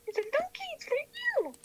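high squeaky voice. Pretend. Squeaky voice giving a present.

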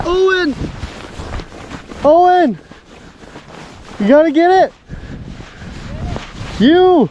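yelling a name across the ice; shouting. Real, not acted. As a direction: shout urgently to a friend. Calling friend to a bite.

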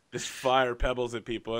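usual speaking voice

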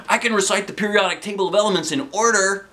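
high, fast eager boast; eager teacher voice. A narrator's reading. Science teacher boasting his talent, high fast boast. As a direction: rattle it off proudly.

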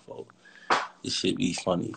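ordinary delivery

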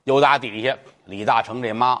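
plain reading voice

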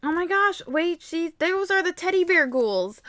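ordinary talk

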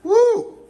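high whoop as if burned; hot yelp. Acted. Yelping at the hot thing in his hand, high whoop. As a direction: yelp like the heat just hit.

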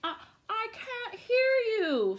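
crafty fox. Acted. Fox luring the gingerbread man closer, sly and high. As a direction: lure him in sweetly. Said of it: "said the fox".

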